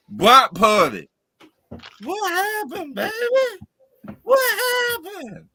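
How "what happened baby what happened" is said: high falsetto, pleading cry; high mock-tearful what happened baby. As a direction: whine it like a heartbroken fan. Mock pleading to Brock Purdy.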